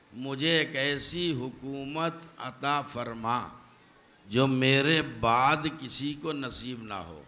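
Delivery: slow prayer. Acted. Solomon's prayer voiced.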